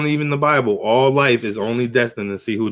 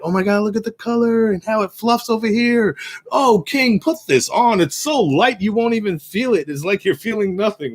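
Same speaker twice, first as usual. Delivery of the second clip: high, sugary sales pitch; fawning con men; 9 semitones higher than usual. Retold or imitated. Con men urging the king to put it on, high sugary coaxing. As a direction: coax the king into the robe sweetly.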